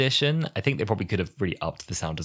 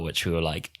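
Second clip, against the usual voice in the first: ordinary talk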